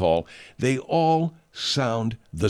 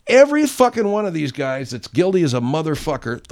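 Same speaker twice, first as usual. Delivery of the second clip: ordinary talk, nothing marked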